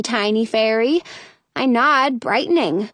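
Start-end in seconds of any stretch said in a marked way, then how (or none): none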